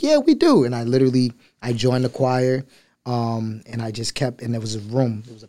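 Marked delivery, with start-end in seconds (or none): 0.0-0.6 high, bright grandma reply; imitating his nana. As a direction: nana answering about the choir. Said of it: "She's like".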